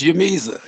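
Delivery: Yamiza begun with a Y sound; correct name pronunciation. Shown as a demonstration. Guest corrects host on his name, Yamiza with a Y. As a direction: say Yamiza, Y in place of J. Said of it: "it's pronounced Yamiza".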